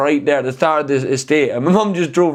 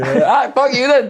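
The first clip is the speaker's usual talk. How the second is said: high, drawn-out comic indignation; mock angry outburst; 10 semitones higher, 4 dB louder, slower than usual. Friend acts out the road row, mock angry outburst. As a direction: throw it out in pitched-up mock fury.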